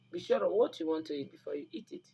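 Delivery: usual speaking voice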